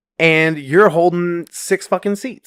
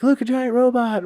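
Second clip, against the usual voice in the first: put-on high, childish gasp of delight; a kid blurting out in the theater; mock little kid; 10 semitones higher than usual. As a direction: imitate a kid pointing at the screen. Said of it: "And he's like".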